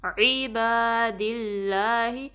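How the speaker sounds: very slow, drawn-out recitation of the greeting. Shown as a demonstration. Teacher reciting the phrase for students to repeat. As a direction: stretch each vowel in a measured chant. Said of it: "دوبارہ آئین آیا ہے".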